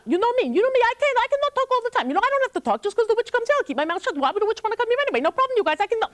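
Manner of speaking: put-on high, fast, boastful boy; a chatty youth brushing off the warning; Sasha.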